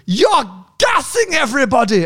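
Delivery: high-pitched accusing cry; hammed-up scolding, pitched high; mock irate yell. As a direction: imitate an outraged Dutch woman. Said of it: "this woman starts coming up to my car and yelling".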